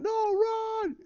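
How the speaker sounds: high, frantic yelp of terror; panicked scream. Retold or imitated. Terrified rebels flee from Vader, a high panicked scream. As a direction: shriek it like a fleeing rebel. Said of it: "They're sitting there screaming like".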